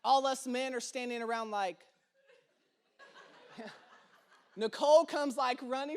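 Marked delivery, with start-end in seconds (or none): none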